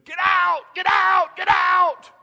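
full-throated, high-pitched shout at the TV; excited sports-fan scream, very loud. Retold or imitated. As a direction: holler it as the ball flies out. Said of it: "And I'm screaming".

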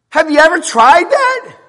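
high, loud shouted question; preaching challenge. Real, not acted. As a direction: fling the question at the congregation. Preaches at full force.

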